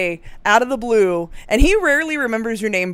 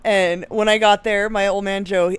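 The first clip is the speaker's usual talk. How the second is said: usual speaking voice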